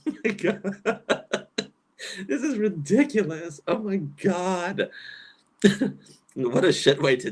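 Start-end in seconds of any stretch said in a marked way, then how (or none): 0.1-1.7 stretched-out laughing oh my God. Real, not acted. Laughing at absurd video. 2.3-5.7 laughing disbelief. Real, not acted.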